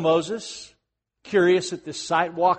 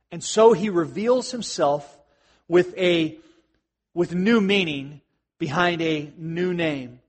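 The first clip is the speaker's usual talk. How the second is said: usual speaking voice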